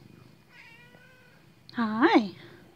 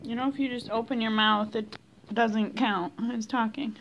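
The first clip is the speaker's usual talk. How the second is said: ordinary delivery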